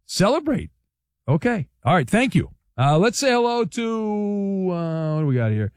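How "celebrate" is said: sarcastic. Acted. Sarcastic reply to co-host.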